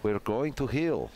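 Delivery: mock Russian commentator; hammy Russian accent. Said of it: "commentate this match in a Russian accent".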